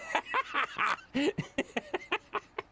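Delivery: long, high-pitched laugh; real amused laughter. Real, not acted. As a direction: crack up high. Laughs at a chat comment.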